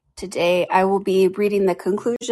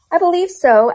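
high, pondering little Alice; thoughtful; 11 semitones higher, 7 dB louder than usual. A narrator's reading. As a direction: answer as if mulling it over. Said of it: "Alice replied thoughtfully".

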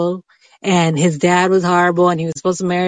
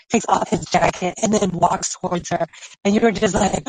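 ordinary talk

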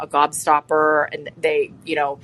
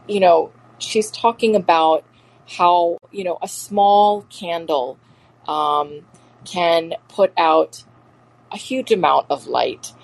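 ordinary talk, nothing marked

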